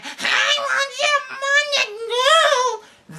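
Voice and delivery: very high, slow, strained creature cry; gremlin voice. Retold or imitated. Imitating a gremlin from a movie for her listeners, strained high cry. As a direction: screech it like a greedy little gremlin. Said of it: "that little gremlin thing that was like".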